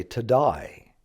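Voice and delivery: "to die" for today, Australian vowel; Australian pronunciation. Shown as a demonstration. Teacher shows how Australian friends say today, Australian "to die". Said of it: "friends who are from Australia".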